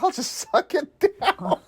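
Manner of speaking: squeaky, half-laughing impression; high comic voice. Toothless man answering about his hot dog, high comic voice.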